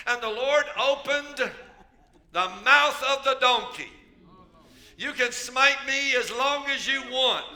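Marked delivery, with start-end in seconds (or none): 5.0-7.4 loud, rising preacher's cry; full-force preaching. Real, not acted. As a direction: preach it hard, building to a shout. Preacher building to climax.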